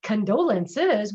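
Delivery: "Condolences?" drawn out slowly like a jingle; mock sing-song "Condolences?", very slow. Retelling a receptionist's call, sing-song mock "Condolences?". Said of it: "like it was part of a jingle".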